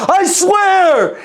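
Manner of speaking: pleading yell; emphatic shout. Retold or imitated. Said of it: "And I'm over here like".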